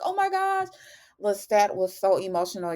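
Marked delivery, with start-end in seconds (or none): 0.0-0.7 much higher, stunned "oh my gosh"; shocked gasp. Retold or imitated. Viewer reacting to an emotional Lestat scene, stunned and high. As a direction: caught off guard by the scene.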